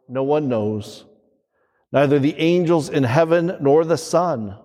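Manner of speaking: ordinary talk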